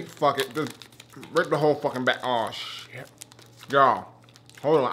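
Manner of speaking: ordinary delivery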